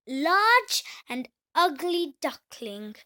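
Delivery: plain reading voice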